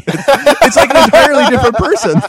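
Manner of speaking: high, loud, laughing words; squealing with real laughter; helpless laughter. Real, not acted. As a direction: barely get it out for laughing. Cracking up about the arm.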